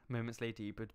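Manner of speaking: ordinary talk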